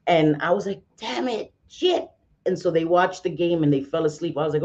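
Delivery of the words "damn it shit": high-pitched mock cursing; small, squeaked curse of defeat, acted out; retold frustration. Retelling losing the TV to son.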